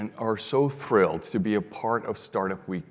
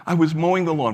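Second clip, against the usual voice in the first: higher and louder, stressing "mowing"; upset young man; 7 semitones higher, 7 dB louder, faster than usual. Retold or imitated. Young man tells Frank what happened, loud and higher. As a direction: blurt "I was mowing the lawn" in distress.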